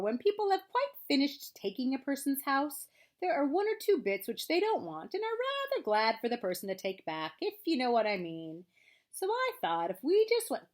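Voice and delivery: long-suffering, self-pitying grumble; melancholy Eeyore. A narrator's reading. Reads Eeyore's lines.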